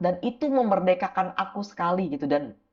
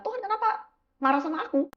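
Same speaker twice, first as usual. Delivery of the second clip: higher, a touch hurt and puzzled; whiny plea to God; 10 semitones higher than usual. Retold or imitated. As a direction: ask God why, a bit wounded. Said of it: "Aku bilang".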